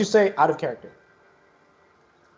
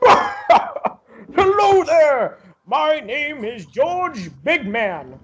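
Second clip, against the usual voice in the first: high, slow, cheery NPC greeting; George Bigman; 10 semitones higher, 6 dB louder, much slower than usual. Acted. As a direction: stretch it out in a high, beaming stranger voice. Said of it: "and he goes".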